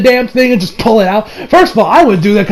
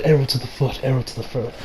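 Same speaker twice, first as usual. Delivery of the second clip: fading echo; 7 semitones lower, 11 dB quieter than usual. Pretend. Reviewer jokes about the arrow, fading echo. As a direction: repeat it, softer and softer, like an echo. Mock echo of his own line.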